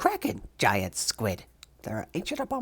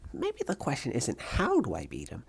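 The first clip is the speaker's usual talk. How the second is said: ordinary talk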